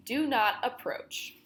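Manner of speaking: plain reading voice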